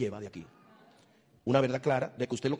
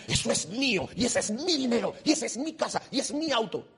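higher, possessive whine; mock greedy owner; 8 semitones higher than usual. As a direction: play the person clutching their things. Voicing a greedy possessive person.

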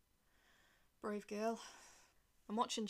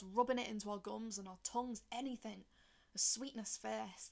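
plain reading voice

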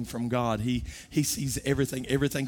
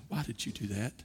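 God speaking to the heart at night, hushed; soft voice of God; 6 dB quieter than usual. Retold or imitated. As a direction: gently press the conscience. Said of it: "he'll say".

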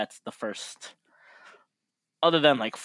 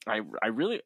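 normal talking voice

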